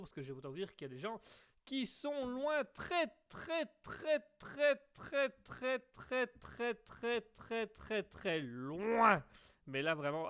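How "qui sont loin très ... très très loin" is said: repeated très climbing high, then slowly fading into a loud drawn loin; exaggerated very far. Host exaggerating for listeners, très repeated higher then a slow drawn loin. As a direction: pile up the très, higher at first, stretching out loin. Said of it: "je ne fais qu'exagérer à demi-mot".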